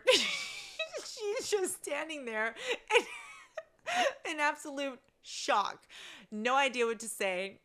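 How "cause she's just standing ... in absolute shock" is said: words squeezed out through laughter, high; trying to talk while cracking up; laughing. Real, not acted. Laughing while telling story.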